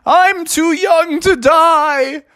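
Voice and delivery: very high, slow melodramatic wail; moaning raccoon. Acted. Bitten raccoon lamenting his end, wailing. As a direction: moan out a hammed-up deathbed lament. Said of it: "a moaning raccoon going".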